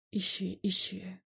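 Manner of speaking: slow, soft goading; smiling taunt. A narrator's reading. Emil egging Andrei on over the phone, smiling taunt. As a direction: taunt him softly, with a smile. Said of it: "с улыбкой глядя на меня".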